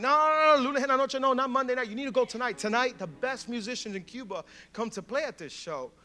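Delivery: high, loud burst of no's easing into urging; insistent protest. Retold or imitated. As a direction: cut them off and insist, voice shooting up. Acted local insisting on tonight.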